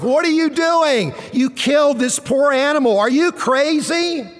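husband shouting at his wife in horror; retold shout. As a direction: shout at her in shock. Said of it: "I shouted at my wife".

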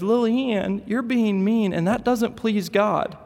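put-on high, slow little boy lecturing his sister; a preachy four-year-old telling her off; scolding little boy. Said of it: "he turns to her and he says".

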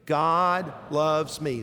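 very slow, each word given room; leading recitation. Shown as a demonstration. Preacher asks congregation to say it again, very slow recitation. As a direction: draw out each word for a group to echo. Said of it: "Can you say that with me?".

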